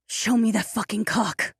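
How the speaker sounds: high, raspy woman's demand. A narrator's reading. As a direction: rasp it out with lust. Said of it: "her voice raspy with passion".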